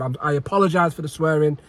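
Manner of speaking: usual speaking voice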